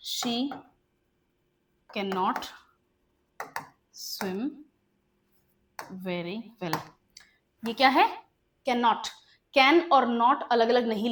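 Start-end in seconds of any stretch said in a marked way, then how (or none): 0.1-6.8 slow dictation. Shown as a demonstration. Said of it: "dictating word by word".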